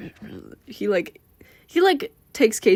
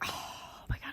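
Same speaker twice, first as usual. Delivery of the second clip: barely voiced, breathy whisper; inner thought; 17 dB quieter than usual. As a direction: whisper it to yourself in awe. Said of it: "her mind, she's like".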